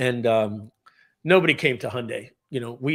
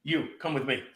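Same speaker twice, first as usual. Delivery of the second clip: impression of John Chimella; 5 dB quieter than usual. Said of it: "I'm gonna do my impression of, of John Chimella".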